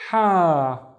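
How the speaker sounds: "ha" held twice as long; breathy h into a lengthened "ha"; long vowel. Shown as a demonstration. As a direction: draw out the "a" in "ha". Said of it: "making the ha twice as long".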